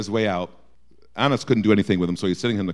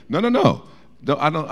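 ordinary talk

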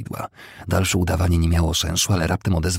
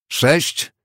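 high, loud count; resounding call; 10 semitones higher, 4 dB louder than usual. A narrator's reading. Second counting paces at a duel, loud call. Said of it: "zawołał donośnie Smith".

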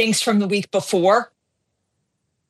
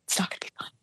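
hushed despair; 14 dB quieter, much faster than usual. Retold or imitated. Retelling her despair to husband.

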